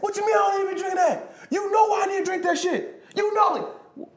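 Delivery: very high, loud protesting shout; indignant shout. Retold or imitated. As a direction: yell back defensively.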